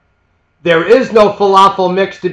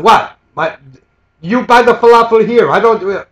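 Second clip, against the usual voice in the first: a loud, higher, retold indignant outburst; mock angry vendor; 5 semitones higher, 5 dB louder than usual. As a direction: play the irritated shop owner, louder. Angry vendor snapping at customer.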